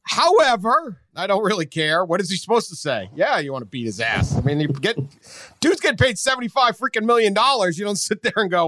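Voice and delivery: ordinary talk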